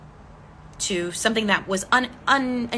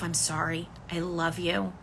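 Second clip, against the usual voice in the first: soft, quiet and tender; tender inner apology; 3 semitones lower, 8 dB quieter than usual. Shown as a demonstration. Coach guides listener comforting their inner child, softly. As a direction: say it gently to your younger self. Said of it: "Just tell yourself".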